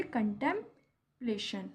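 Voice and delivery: slow "कंटेम्पलेशन", pausing before the final "plation"; broken into parts. Shown as a demonstration. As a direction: say "कंटेम्पलेशन" as "contem", pause, "plation". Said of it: "पहले बोले".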